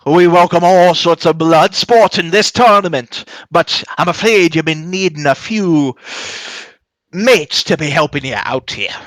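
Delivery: loud, high-pitched showman dwarf; dwarf announcer. Acted. Dwarf host addresses fighters and crowd, big dwarf voice. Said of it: "the, the dwarf up here says".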